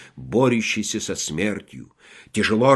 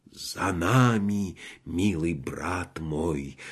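slow, soft and much lower; tender; 6 semitones lower, 5 dB quieter, much slower than usual. Acted. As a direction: gently reassure a dying soldier. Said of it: "ласково ответил фельдмаршал".

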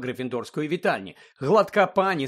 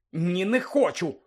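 usual speaking voice

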